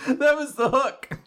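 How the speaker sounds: helpless amusement in the voice; real laughter. Real, not acted. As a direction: confess it while still cracking up. Still laughing at own forgetting.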